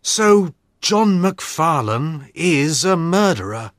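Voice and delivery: understated sorrowful disbelief; sad. Acted. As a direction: ask with a touch of sadness. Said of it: "said Watson sadly".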